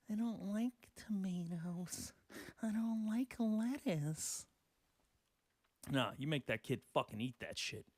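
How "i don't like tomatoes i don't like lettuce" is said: put-on slow, hushed childish whine, then higher; mock whiny kid. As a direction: imitate a picky kid refusing vegetables, drawn out. Kids picking food off plate.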